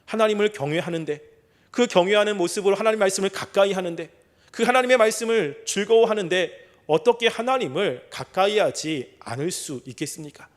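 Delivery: usual speaking voice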